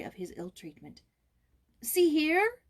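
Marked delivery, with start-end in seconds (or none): 1.8-2.6 higher and louder, calling her to look; boy crying out to his friend, urgent; boyish cry. A narrator's reading. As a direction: cry out to show the welts. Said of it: "he cried in conclusion".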